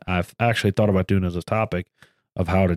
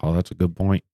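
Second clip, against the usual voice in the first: usual speaking voice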